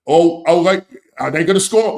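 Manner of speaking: wait, is this going in; excited live reaction. Real, not acted. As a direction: react as a chance builds on goal.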